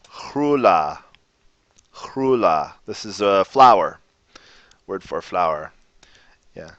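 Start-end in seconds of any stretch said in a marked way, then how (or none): 0.1-2.7 Hrula with a raspy ch start; throaty h. Shown as a demonstration. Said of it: "let's see with some words".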